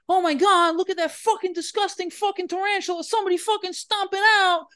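very high, squealing mock disgust; hammed-up squealing horror; disgusted shriek. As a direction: shriek like someone who just saw a spider.

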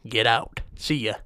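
ordinary talk

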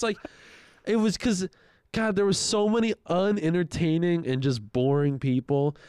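ordinary delivery

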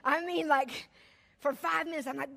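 ordinary talk

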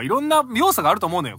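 ordinary delivery

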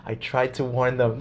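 imitating grim Musk, slow and quiet; slow grave Elon Musk. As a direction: let it land slow and hushed. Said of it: "He says it with that tone of voice".

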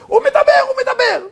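very high, loud cry of amazement; excited shouting. Retold or imitated. Mother telling the family her son is talking, excited shouting. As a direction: call it out high to the whole family. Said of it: "צועקת".

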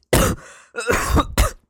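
harsh rising cough; coughing up water. Real, not acted. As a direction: choke and cough hard. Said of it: "went down the wrong way".

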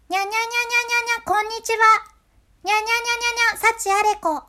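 very high falsetto にゃにゃにゃ then こんにちは at the same height; high falsetto cat voice. Shown as a demonstration. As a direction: squeak like a kitten, then keep that pitch for the words. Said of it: "高い声で発声した後". (裏声で高く猫のように)

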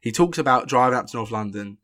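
normal talking voice

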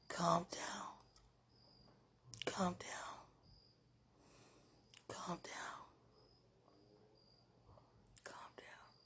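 whispered, fading away to nothing. Real, not acted. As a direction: let each calm down fade into a whisper. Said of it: "ministering unto myself".

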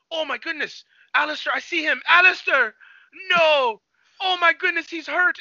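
high-pitched imitation of a frantic father; acted distress, building to loud cries; mock panic. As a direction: call out for a lost son in hammed-up alarm. Father panicking for his son, retold.

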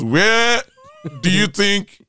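loud, high, long WHERE, then a pause; mother's stern demand. Retold or imitated. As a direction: imitate a mother booming out a challenge. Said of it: "She goes".

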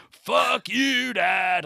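mocking a kid telling dad off; mock rebellious shout. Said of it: "'cause they're like".

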